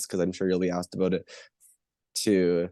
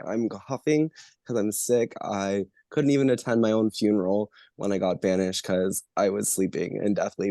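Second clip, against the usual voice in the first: ordinary talk, nothing marked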